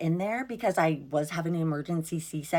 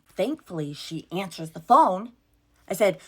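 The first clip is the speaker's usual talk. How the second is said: usual speaking voice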